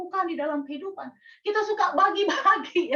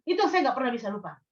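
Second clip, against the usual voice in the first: ordinary talk, nothing marked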